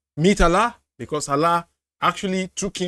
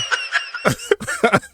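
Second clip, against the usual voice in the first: breathy burst of laughter; 5 semitones higher than usual. Real, not acted. Laughing at clip.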